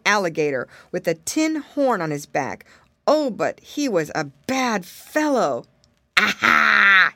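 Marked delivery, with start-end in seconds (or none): 6.2-7.1 loud, gloating "aha"; villain's cry. A narrator's reading. Alligator pouncing on two friends, loud villainous cry. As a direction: cry out like a villain springing a trap. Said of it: "he cried".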